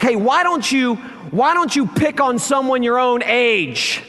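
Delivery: high, firm retold rebuke; confronting bully. Pastor tells the angry driver to pick on someone his age, firm high rebuke. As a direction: scold the bully from the car window.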